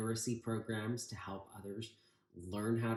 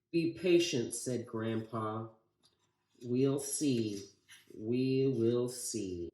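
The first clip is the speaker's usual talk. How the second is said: put-on lively read-aloud for children, higher and louder; story reading; 3 semitones higher, 6 dB louder than usual. A narrator's reading. Teacher reading The Butter Battle Book to the class, lively read-aloud.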